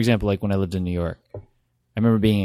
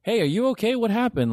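high, caring friend voice; concerned; 13 semitones higher than usual. Retold or imitated. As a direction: ask gently and with concern. Acting out a caring innocent friend.

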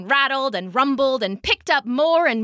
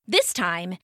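ordinary delivery, nothing marked

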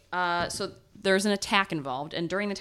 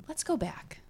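whispering; 2 semitones higher than usual. Acted. Opening the story.

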